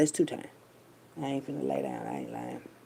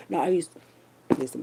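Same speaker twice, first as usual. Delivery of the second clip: ordinary talk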